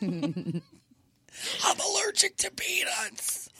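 breathy whispered aside; whispering. Pretend. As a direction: whisper it up high. Whispered joke reply.

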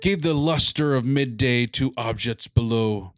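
reading a Christmas poem with drama; dramatic poem reading. Acted. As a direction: recite it like a storyteller. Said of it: "like, dramatic".

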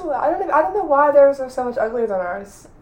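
ordinary talk

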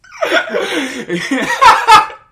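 very loud, high belly laugh; laughing fit. Real, not acted. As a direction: explode into helpless cackling. Laughing at movie scene.

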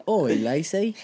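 playful put-on Australian accent. Australian suitor approaching Steve Irwin's daughter, Australian accent. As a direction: call out in a mock Australian twang. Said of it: "I'm from Australia".

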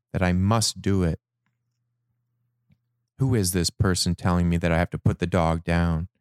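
ordinary delivery

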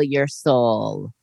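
very low, slow spooky voice. Shown as a demonstration. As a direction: drop into a deep ghostly voice. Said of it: "After the spooky laugh for Halloween".

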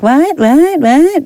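high, far-off put-on voice, pitch way up; silly high "what, what, what?"; mock clueless owner. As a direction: play a dopey owner who doesn't get it. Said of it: "they're like".

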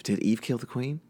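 normal talking voice

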